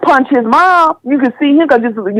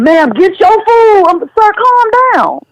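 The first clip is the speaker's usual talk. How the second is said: higher, slow, calming words to an angry man; calm pleading; 9 semitones higher, slower than usual. Retold or imitated. Woman retold calming the man who confronts her, high, slow and pleading. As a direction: ease him down gently, pitched high and slow.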